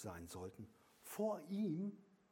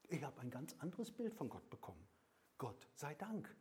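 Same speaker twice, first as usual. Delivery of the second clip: ordinary delivery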